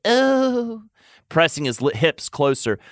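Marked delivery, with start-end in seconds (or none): 0.1-0.8 high, drawn-out acted moan. A narrator's reading. Tamlin reacts to the lover, moaning. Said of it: "Tamlin moaned".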